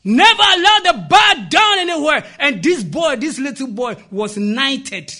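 loud, high proclamation, easing at the end; preaching. Real, not acted. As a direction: hammer the lesson home, then settle. Preaches the moral at full force.

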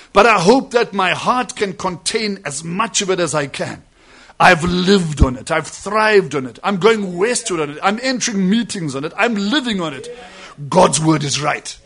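preacher voices the prophet's declaration; fervent preaching. Acted. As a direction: proclaim each line like a creed. Quoting the prophet with fervor.

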